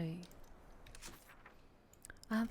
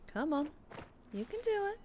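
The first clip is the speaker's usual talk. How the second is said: encouraging; 9 semitones higher, 4 dB quieter than usual. Real, not acted. Coaxing through a tight spot.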